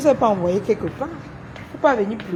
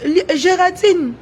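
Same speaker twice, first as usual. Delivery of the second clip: raised call-out; 6 semitones higher, 5 dB louder than usual. Real, not acted. Calling out a rival on live.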